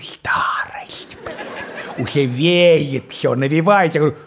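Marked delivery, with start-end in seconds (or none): 0.0-2.0 laughing voiceless whisper; soft, lower whisper. Acted. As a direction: whisper it with a chuckle. Sad joke about aging.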